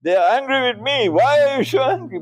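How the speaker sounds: high, fast mock complaint; high mock whine of angry critics; mocking quote. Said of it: "He uses angry language".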